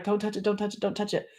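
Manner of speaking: soft, rapid-fire mock warning; hushed repeated plea, acted out; overwhelmed restraint. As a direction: fend off a touch under the breath. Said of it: "That feeling of, like".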